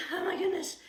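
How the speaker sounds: ordinary talk, nothing marked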